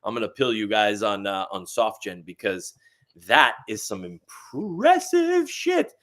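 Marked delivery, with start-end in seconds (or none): 3.3-5.9 excited. Real, not acted. Raving about SoftGen.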